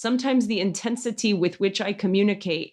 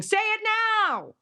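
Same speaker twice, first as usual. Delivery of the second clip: urgent inner voice; 13 semitones higher than usual. Retold or imitated. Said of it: "an engine of pressure in your body saying".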